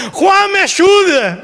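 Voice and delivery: high, drawn-out mock plea; cry for help. Preacher imagines begging his friend for help, high mock cry. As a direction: call out for help in playful desperation.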